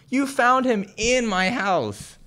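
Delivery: high, loud, slow and indignant; outraged retort. Acted. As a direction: spell it out to the cops. Grandmother outraged at the cops.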